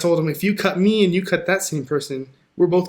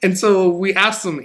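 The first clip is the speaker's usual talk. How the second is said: usual speaking voice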